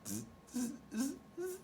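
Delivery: soft, high mocking zzz; monkey buzz. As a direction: buzz back like a cheeky monkey. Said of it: "and said".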